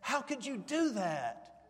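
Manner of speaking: slow, high, incredulous prophet's voice rising to a cry; a prophet aghast at his people; disbelief. Retold or imitated. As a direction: plead in stunned disbelief, dragging the words out. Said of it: "can't you just hear the disbelief in Samuel's voice".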